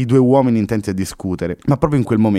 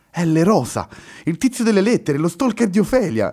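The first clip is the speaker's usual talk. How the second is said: much higher, louder sudden realization; astonished exclamation; 11 semitones higher, 3 dB louder than usual. Acted. Luna recognizes the name on the door, astonished. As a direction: it hits her all at once. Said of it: "esclamò stupita".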